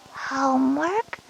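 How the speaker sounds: slow, soft rising question; a small, uncertain query; worried questioning. Acted. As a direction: draw it out softly, taken aback. Child startled by mention of homework.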